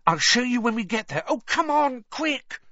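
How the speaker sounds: high-pitched, impatient hurrying; acted excited impatience; urgent urging. A narrator's reading. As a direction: urge them on, rising to a high "quick". Girl urgently dragging friends along.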